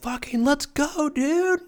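very high, slow hyped cheer; hyped-up shout. Pretend. Happy dog owner cheering, very high hyped voice. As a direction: cheer like you're pumped with your dog. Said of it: "then you're like".

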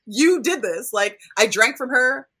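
high, loud mock blaming; exasperated parent. Parents arguing over who turned the girl, imitated as loud exasperation. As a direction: act out an indignant quarrel, pushing the accusation.

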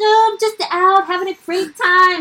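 high, loud put-on cheeriness; hammed-up cheerful cover story; fake cheerful. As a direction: oversell the good time, mock-breezy. Retold cover story to calling mom.

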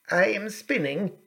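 a hint of an old spinner; old woman. A narrator's reading. Old woman answers princess.

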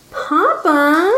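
put-on high childlike whine; whining. A narrator's reading. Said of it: "the woman whined".

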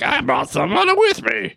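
a so-so take on the comedian's voice; Patton Oswalt. Retold or imitated. Retelling the cameo, a lightly voiced impression of the comedian. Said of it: "that's my Patton Oswalt impression".

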